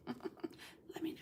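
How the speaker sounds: a laughing unvoiced whisper. Real, not acted. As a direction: slip it in as a hushed aside. Whispered aside after laughing.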